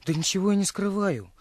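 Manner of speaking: ordinary delivery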